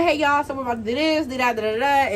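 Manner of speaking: ordinary talk, nothing marked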